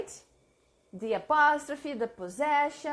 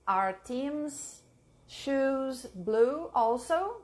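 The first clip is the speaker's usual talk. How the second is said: slow dictation; much slower than usual. Shown as a demonstration. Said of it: "Please write it down".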